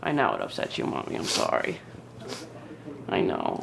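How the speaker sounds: choked, whispered apology; barely voiced through tears; tearful whisper. Real, not acted. Apologizing to her upset mother, whispering through tears.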